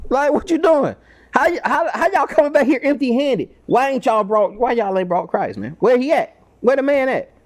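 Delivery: high, scolding street voice; indignant chief priests. Retold or imitated. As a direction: scold the officers like exasperated bosses. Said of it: "and they say".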